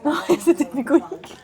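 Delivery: laughing through the words. Real, not acted. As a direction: laugh while you correct him. Teasing about an unusual word.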